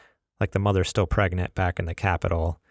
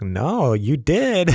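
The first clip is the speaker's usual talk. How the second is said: higher, louder retold contradiction; mock insistence; 7 semitones higher, 4 dB louder, much slower than usual. Host retelling his comeback to co-host, mock insistence. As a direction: playfully argue back, imitating the dispute. Retells playful argument over a curse.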